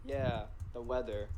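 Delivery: low, deadpan retold reply; awkward agreement. Boy answering a shy girl's small talk, retold awkwardly. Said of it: "And I'm like".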